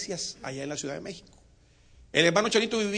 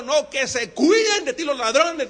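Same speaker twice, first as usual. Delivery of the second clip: loud retort; 9 semitones higher, 10 dB louder, slower than usual. Acted. Wensmayer's retort.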